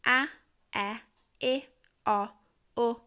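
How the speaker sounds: short pure vowel sounds one by one. Shown as a demonstration. Teacher models the vowels for a parent. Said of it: "the vowels at the bottom".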